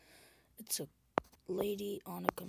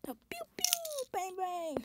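gun noises. Shown as a demonstration. Imitating a gun firing.

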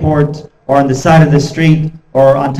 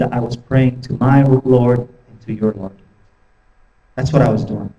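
steady and gentle, not rising to it; calm reply; 4 semitones lower, 5 dB quieter than usual. Retold or imitated. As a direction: answer the shouting with quiet dignity. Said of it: "you know how he replied".

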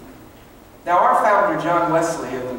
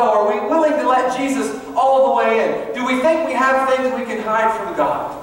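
urgent, higher-pitched pulpit appeal; impassioned preaching; 6 semitones higher, 4 dB louder than usual. Real, not acted. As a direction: press the congregation with each question. Preaches at full force.

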